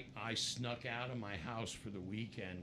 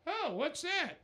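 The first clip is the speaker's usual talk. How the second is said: Ronnie's voice; 6 dB louder than usual. Retold or imitated. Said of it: "it was Ronnie".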